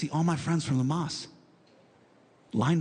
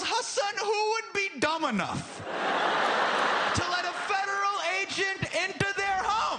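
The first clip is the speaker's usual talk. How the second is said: dad's exasperated shout. Retold or imitated. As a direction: scold it like an exasperated father. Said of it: "he goes".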